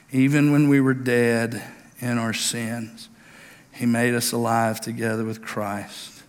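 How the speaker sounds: slow, drawn-out Bible reading; solemn preacher's reading; scripture reading. Acted. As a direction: read the verses with weight. Reads scripture aloud.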